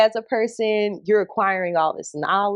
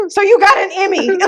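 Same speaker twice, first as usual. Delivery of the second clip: laughing through tears; 12 semitones higher, 7 dB louder than usual. Retold or imitated.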